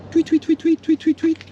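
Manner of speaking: high, piping mock birdcall; bird tweeting. Showing viewers the local birdies, imitated tweeting. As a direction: chirp quick repeated tweets way up high.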